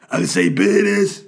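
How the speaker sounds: high, loud rasping [name] voice. Retold or imitated. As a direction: growl it out like a rapper. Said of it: "Was that a shit [name] impression?".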